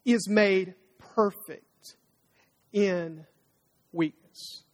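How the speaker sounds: very slow, fading to a hush on "weakness"; weighty, slowing sermon punchline; slow emphasis. Acted. Preacher landing the key verse.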